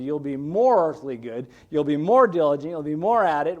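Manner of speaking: ordinary talk, nothing marked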